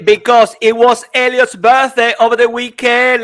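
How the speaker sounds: loud, high-pitched birthday hype; excited announcement. Real, not acted. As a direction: burst out with the big news.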